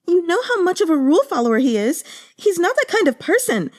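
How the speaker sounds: put-on high, quick, eager young maid telling tales; maid's gossiping voice. Yuan Yang protests again.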